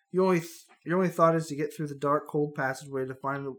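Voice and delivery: normal talking voice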